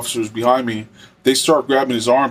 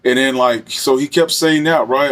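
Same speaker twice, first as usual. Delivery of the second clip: ordinary talk, nothing marked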